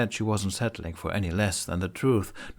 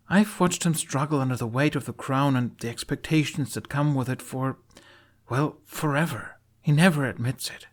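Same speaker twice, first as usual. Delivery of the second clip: higher voiced young warrior, earnest; Astrid; 7 semitones higher than usual. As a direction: open up about her friend.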